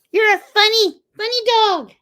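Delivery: high, doting pet voice. Real, not acted. Cooing at dog.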